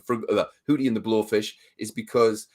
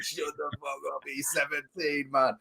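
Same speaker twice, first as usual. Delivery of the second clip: muttered under breath; 3 semitones higher, 9 dB quieter, slower than usual. Retold or imitated. Fed up at school disco.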